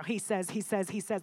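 stumbling over the same phrase; stuttered repetition. Real, not acted. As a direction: get stuck restarting the phrase. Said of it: "A little stutter for a second".

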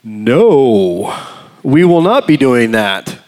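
drawn-out, punched "no"; inner refusal. Acted. Preacher voicing his inner resistance to visiting Jim, firmly refusing. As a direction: dig in with firm emphasis. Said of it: "everything inside of me was like".

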